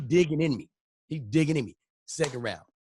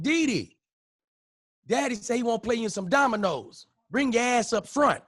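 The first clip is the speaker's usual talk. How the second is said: higher, calling him up front; sister calling out; 8 semitones higher than usual. Retold or imitated. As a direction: call him in like his sister. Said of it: "my sister said".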